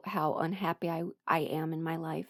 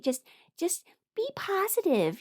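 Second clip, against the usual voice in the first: higher, sing-song mockery of empty positivity; imitating a dismissive friend, lightly voiced; mock chirpy advice; 11 semitones higher than usual. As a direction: parody the people who say just cheer up. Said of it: "Or people would be like".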